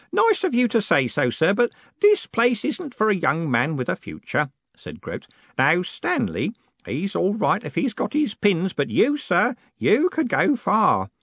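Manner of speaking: put-on high, earnest old postman giving advice; old postman Grote. A narrator's reading. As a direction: urge him gently to leave, old man's voice. Old Grote voiced in narration.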